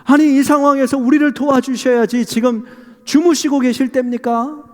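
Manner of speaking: high-pitched, indignant remonstrance; aggrieved disciple demanding help; complaining protest. Acted. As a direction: take the Lord to task. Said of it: "불평을 섞어가면서 막 주님께 따집니다".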